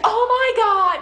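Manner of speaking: loud, high oh my God; the friend finally gets it, shocked and loud; shocked exclamation. Retold or imitated. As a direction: gasp it out in shock. Friend learns they are together.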